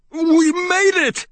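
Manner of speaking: high, slow, gasping relief; spluttering. Acted. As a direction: sputter it out after surfacing. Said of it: "I spluttered".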